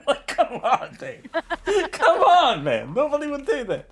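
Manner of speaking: high-pitched, incredulous protest; laughing disbelief. Real, not acted. Friend scoffing at random door knocking, laughing disbelief. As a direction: exclaim it while cracking up.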